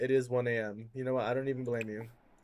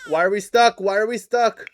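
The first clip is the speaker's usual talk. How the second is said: louder and higher, real frustration; frustrated shout; 8 semitones higher, 12 dB louder than usual. Real, not acted. Gamer shouting at a stuck game in real frustration. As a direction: yell it at the frozen game. Game freezes, babies crying.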